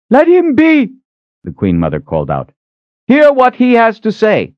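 put-on high, loud commanding old queen; Queen Mother calling out. A narrator's reading. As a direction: call out over the crowd as the queen.

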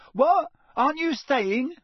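high, startled outburst; blurted out. A narrator's reading. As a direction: blurt it out in sudden alarm. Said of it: "Ron blurted out".

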